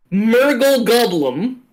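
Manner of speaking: "Murgle Goblum" carefully sounded out as intended; intended pronunciation. Shown as a demonstration. Game master demonstrates the first name, "Murgle Goblum" sounded out. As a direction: say "Murgle Goblum" as two separate made-up words. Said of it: "say how he said they are supposed to be pronounced".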